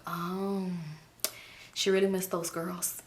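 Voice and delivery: mock sympathy muttered to herself; sarcastic aside.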